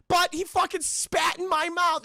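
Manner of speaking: high, loud, drawn-out complaint; whiny mock outrage at the coach; indignant protest. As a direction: plead your case in outraged disbelief.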